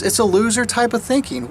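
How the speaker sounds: ordinary talk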